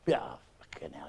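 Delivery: patient learns he must live with it, whispering; despairing whisper. Acted. Patient despairs at bad news.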